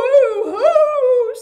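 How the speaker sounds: high loud fake boo-hoo wail; hammed-up sobbing. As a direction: blubber like a cartoon crybaby. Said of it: "sobbed the dogs".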